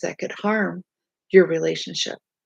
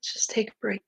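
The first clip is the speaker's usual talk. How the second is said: soft whispered advice; calm whisper; 7 dB quieter than usual. Shown as a demonstration. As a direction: breathe it out gently. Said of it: "just calmly say".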